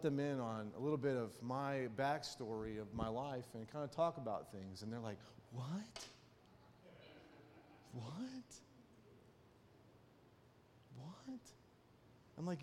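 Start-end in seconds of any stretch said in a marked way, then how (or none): 5.5-11.4 hushed, rising disbelief; mock stunned students. Students hearing their teacher's backstory, softly incredulous. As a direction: imitate astonished students, softly, pitch sliding up each time.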